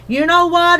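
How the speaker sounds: a touch of sudden eagerness; sudden exclamation. A narrator's reading. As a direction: blurt it out as a sudden idea. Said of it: "exclaimed Arietta suddenly".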